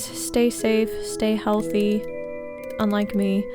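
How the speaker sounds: ordinary delivery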